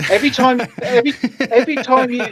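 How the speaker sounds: high, loud words broken by laughter; cracking up mid-sentence; laughing. Real, not acted. Host laughing at cohost's noisy vape.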